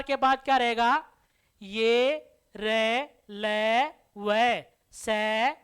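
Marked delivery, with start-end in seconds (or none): 1.6-5.6 each letter drawn out very slowly; slow recitation. Shown as a demonstration. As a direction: chant the letters one by one for the class. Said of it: "reciting the letters slowly". (धीमे स्वर में अक्षर गिनाना)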